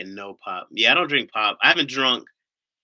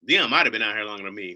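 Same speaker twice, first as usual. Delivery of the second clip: usual speaking voice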